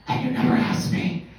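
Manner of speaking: harsh whispered shouting; whisper yelling. Retold or imitated. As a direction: yell it under your breath. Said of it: "their fighting language is something called whisper yelling".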